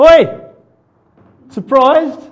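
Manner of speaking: high, loud playful "Oi!"; mock shout. Jesus popping up in front of the market crowd, loud mock shout. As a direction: yell it across the crowded market, teasing.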